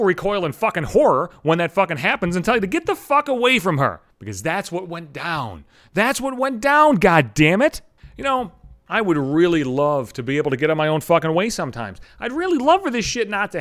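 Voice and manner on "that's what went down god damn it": higher, louder, drawn-out exasperation; slow it right down and push it out, exasperated; exasperated outburst. Real, not acted. Podcaster owning up to his embarrassment, exasperated. Exasperated repeat of the same admission.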